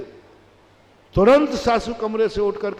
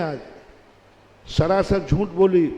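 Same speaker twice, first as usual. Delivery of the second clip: usual speaking voice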